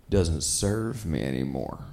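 low, slow meek voice; passive friend. Retold or imitated. Passive friend explaining the chicken joint, low meek voice. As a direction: mumble it meekly and low. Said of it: "and he said".

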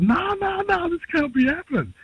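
high, stunned no no no; can't believe it, voice shooting up; disbelief. Retold or imitated. Musician retelling his shock at winning the award, high disbelief.

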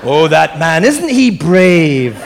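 slow, drawn-out mock Scottish accent; a lightly hammed-up Scottish engineer; a hint of Scottish accent, slowed way down; Scotty's Scottish accent. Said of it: "Scotty in Star Trek".

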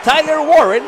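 building to a screamed touchdown call; excited play-by-play. Real, not acted. Narra en vivo el touchdown. (narración eufórica de gol)

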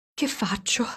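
anxious whisper; whisper to himself. Acted. Carlo at the door whispers to himself, anxious. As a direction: breathe it under your breath. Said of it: "si disse".

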